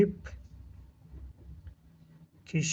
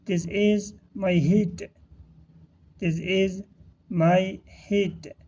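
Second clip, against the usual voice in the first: slow, word-by-word teaching delivery; drawn-out words with small gaps between; slow model reading; much slower than usual. Shown as a demonstration. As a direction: model the phrase slowly for learners. Teacher models phrase for learners.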